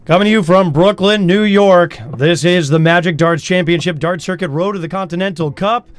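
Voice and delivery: booming, high announcer opening; announcer intro. Acted. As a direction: call it like a big-event host. Announcer opens the broadcast.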